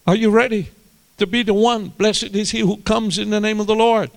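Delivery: high, loud excited cry of news; triumphant high-pitched shout; shouting in the village. Acted. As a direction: call it out to the whole village. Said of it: "she's shouting in the village".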